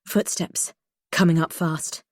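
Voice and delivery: ordinary delivery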